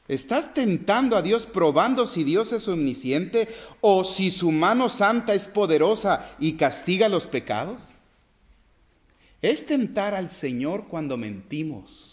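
rising sermon fervor; forceful preaching. Acted. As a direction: press the question on the congregation.

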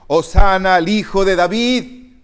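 loud, ringing hosanna call; children's acclaim. Retold or imitated. Preacher voicing children hailing Jesus, loud acclaim. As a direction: cry it out like the children in the temple.

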